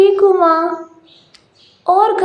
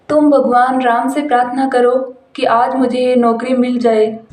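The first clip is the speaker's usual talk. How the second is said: lowered male voice, eager; hopeful husband; 4 semitones lower than usual. Acted. Husband tells his wife about a job interview, lowered male voice. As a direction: tell her the good news, low and hopeful.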